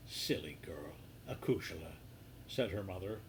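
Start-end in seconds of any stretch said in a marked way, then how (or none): 0.1-2.0 soft hushed whisper. Acted. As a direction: breathe it to her daughter secretly. Mother hushes daughter about secret.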